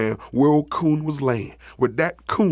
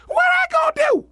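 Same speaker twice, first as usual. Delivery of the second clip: high, loud, quick outcry; startled cartoon raccoon squawk; alarmed raccoon; 5 dB louder, faster than usual. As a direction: yelp it in disbelief. Coon balks at playing dead.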